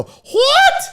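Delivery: very high, loud screech; mock shriek of disbelief, rising; shocked aunt. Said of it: "If you said something that shocked her, she'd go".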